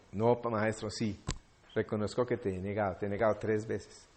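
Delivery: low, subdued retold confession; heartbroken admission. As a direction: confess it softly to the master. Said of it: "Me partió el alma".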